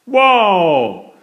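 high, loud wobbly wow; Daddy Pig wowing. A narrator's reading. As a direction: wow while losing balance. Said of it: "says Daddy Pig, wobbling about".

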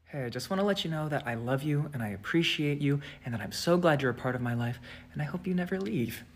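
soft, low, menacing sweetness; creepy version. Shown as a demonstration. With the creepy eyes on, sweet but menacing. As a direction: let it sound malicious. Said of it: "turn the eyes on".

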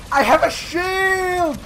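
high, slow desperate yell; panicked shout. Real, not acted. Player under fire yelling, desperate high shout. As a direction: scream it as the shield fails.

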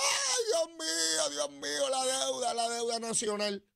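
high, slow hammed-up wail over the debt; mock crying president. Imagining the US president crying, high mock wail. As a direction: whine like a panicked crybaby leader. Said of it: "Imagínense al presidente de los Estados Unidos".

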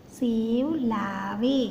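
"सेव लावे" said very slowly, each sound stretched; slow phonics demonstration; slow syllable reading. Shown as a demonstration. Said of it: "व बराबर ए".

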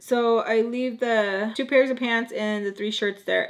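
ordinary delivery, nothing marked

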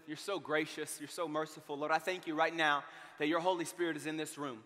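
soft, low opening prayer; hushed pastor's prayer. Acted. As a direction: pray quietly over the room.

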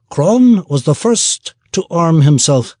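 dwarf Merkelmore reporting what he saw, shifted voice; gruff dwarf. Acted. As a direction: tell it dryly as the dwarf. Dwarf adds.

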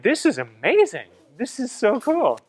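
swooping, high-pitched delight; thrilled. Retold or imitated. Seeing his credit on the news, thrilled and swooping. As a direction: gush with the pitch leaping up and down.

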